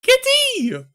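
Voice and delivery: high, loud burst of delight at a cat; excited squeal. Real, not acted. Player spotting the cat on screen, squealing with delight. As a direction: squeal it like you've just spotted a kitten.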